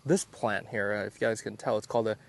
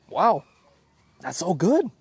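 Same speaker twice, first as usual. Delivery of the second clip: high excited wow; impressed by the flavor; delighted; 5 semitones higher, 4 dB louder, slower than usual. Real, not acted. Tasting delicious fruit.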